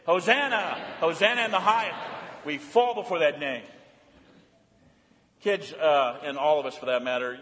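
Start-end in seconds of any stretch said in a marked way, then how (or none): 0.0-2.0 leading the congregation in the shout; call and response. Shown as a demonstration. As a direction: say it together with them. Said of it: "say it with me".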